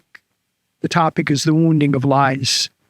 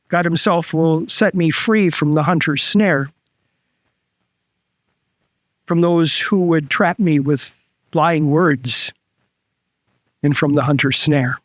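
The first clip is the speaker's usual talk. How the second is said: a lightly solemn scripture voice; scripture reading; 3 semitones lower than usual. Acted. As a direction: read the psalm with reverence.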